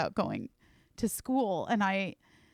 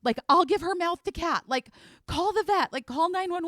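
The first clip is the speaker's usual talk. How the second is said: high, loud frantic pleading; reliving the panic as the cat dies; panicked retelling; 11 semitones higher, 6 dB louder than usual. Retold or imitated. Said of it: "And I was like".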